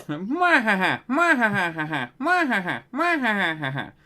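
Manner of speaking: slow hammy mwahaha cackle; evil villain laugh. Pretend. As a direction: cackle like a panto baddie. Evil laugh answers taunt.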